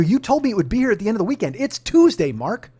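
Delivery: high, loud, indignant reminder; retold confrontation. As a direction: call the salesman out, exasperated. Retold argument with car salesman.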